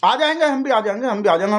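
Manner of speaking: high-pitched protesting promise; hasty insistence. Acted. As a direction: insist down the phone, starting high. Hastily reassuring caller he will come.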